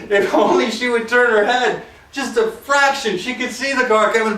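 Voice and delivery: ordinary talk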